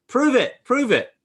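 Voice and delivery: high, loud, slow demand of proof; mock scream. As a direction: heckle the claimed singer. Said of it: "would love to scream out".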